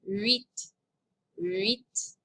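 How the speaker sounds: "huit" with rising tune and French U glide. Shown as a demonstration. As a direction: repeat "huit" as a model, lifting at the end. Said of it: "this has a U sound".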